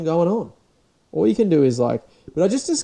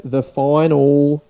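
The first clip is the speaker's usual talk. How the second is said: hyped ad voice; 9 dB louder, much slower than usual. Retold or imitated. Mimicking MasterChef advert hype.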